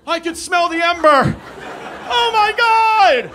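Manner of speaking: high, drawn-out panicked shout; hammed-up panic. Comic reliving Brooklyn panic over the fires, hammed-up scream. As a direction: scream in mock terror, stretching the words. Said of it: "I remember being nervous".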